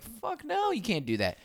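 squeaky-high incredulous no; mock indignant refusal. Imagined staff refusal, acted.

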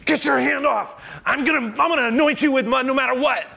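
high, loud hammed-up bully voice; mock pushy Jesus. Imagined Jesus forcing mud on the man, hammed-up and loud. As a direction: parody a Jesus who forces it. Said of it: "Jesus doesn't go".

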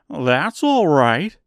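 slow, loud straw man reply; drawn-out scarecrow agreement; creaky scarecrow. Acted. Scarecrow answers Oz.

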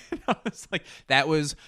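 nervous high laugh, light and breathy. Real, not acted. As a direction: laugh breaking through the words. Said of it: "I'm laughing at".

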